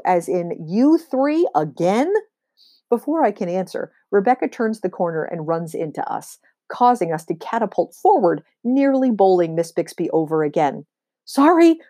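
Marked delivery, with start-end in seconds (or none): 0.7-2.1 "You" hit high, slow; stress on you. Shown as a demonstration. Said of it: "it was always the you". 11.2-11.8 high, loud, breathless "Sorry"; breathless exclaim. Acted. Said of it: "Rebecca exclaims, breathless".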